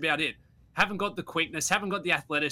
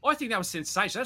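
excited raving; 8 semitones higher than usual. Real, not acted. Host raving about the clips.

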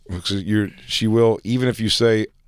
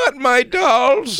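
high, slow horrified plea; pleading mom voice; much slower than usual. Retold or imitated. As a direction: beg like a frightened mom. Playing the mom.

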